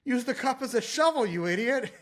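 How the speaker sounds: high, incredulous mock scolding breaking into laughter; exasperated scolding. Trainer correcting a client's blunder, exasperated. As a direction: act out telling off a clueless client.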